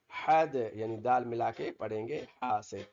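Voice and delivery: normal talking voice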